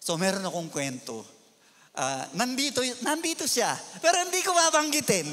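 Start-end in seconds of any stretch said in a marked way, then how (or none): 5.3-5.3 asking through laughter; laughing. Real, not acted. Pastor laughing as he asks the congregation's permission. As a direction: giggle out the question.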